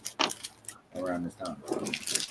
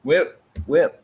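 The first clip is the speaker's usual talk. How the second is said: louder, higher call to get his attention; 6 semitones higher, 5 dB louder than usual. Real, not acted. Streamer calling out to a viewer, loud and higher. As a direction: shout his name out to him.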